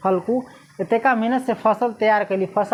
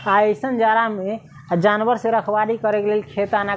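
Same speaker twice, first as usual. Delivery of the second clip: ordinary delivery, nothing marked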